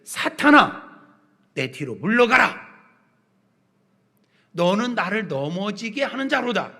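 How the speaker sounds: loud, high cry, then firm command; stern scriptural rebuke. Acted. Jesus addressing Peter as Satan, stern loud command. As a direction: proclaim the rebuke with full authority.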